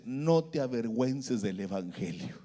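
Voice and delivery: low, soft, solemn divine voice; quiet, low-pitched message from above; Espíritu Santo. Retold or imitated. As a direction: drop low and hushed, as if heard inwardly. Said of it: "el Espíritu Santo le habla y me dice".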